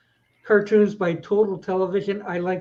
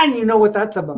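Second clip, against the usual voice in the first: normal talking voice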